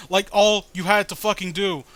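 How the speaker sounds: normal talking voice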